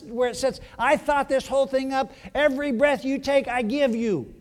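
high-pitched, indignant rebuke; upset God. Acted. God scolds people for grudging sacrifices, upset. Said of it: "God, God is upset".

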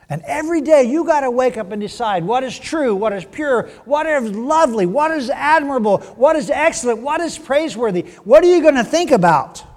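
climbing preacher's list; rising preacher's list. Real, not acted. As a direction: build the list to a climax. Listing the virtues, building up.

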